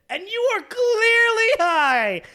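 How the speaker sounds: high, loud, drawn-out wail; exclaimed. Acted. Friend describing hiding a high from parents, exclaimed. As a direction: stretch it out loud and high, mock distress. Mock alarm about being obviously high.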